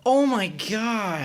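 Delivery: high, loud, long-drawn exclamation; horrified shock. Real, not acted. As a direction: stretch it out in real horror. Horrified at hearing the baby died.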